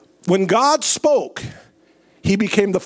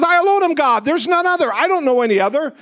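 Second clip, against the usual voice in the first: much higher, ringing declaration of God; God's proclamation; 7 semitones higher, much faster than usual. Acted. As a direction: proclaim it as the Lord himself. Preacher voicing God's declaration.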